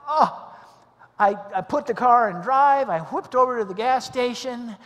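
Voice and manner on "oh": short, loud, high oh; mock-stunned exclamation; flabbergasted gasp. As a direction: act out slack-jawed amazement with an oh. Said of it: "drool almost started to come out".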